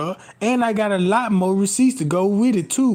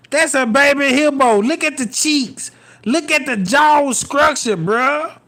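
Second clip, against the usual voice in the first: higher, louder mocking roast; mocking excitement; 5 semitones higher, 3 dB louder than usual. Real, not acted. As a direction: roast her loudly with glee. Mocking photo, loud high.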